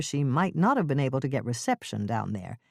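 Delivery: ordinary delivery, nothing marked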